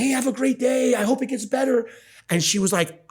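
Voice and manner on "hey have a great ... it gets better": lightly voiced upbeat employee, higher; friendly reply. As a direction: kindly wish her a better day, a touch raised. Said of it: "He's like".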